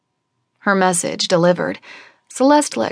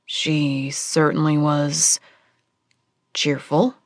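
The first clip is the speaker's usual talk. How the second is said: dry understatement; 3 semitones lower, slower than usual. Acted.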